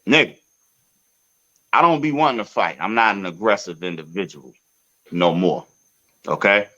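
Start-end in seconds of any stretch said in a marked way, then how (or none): none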